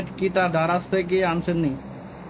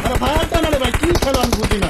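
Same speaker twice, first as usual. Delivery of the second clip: frustrated outburst; 5 semitones higher, 5 dB louder than usual. Real, not acted. Frustrated at teammate's play.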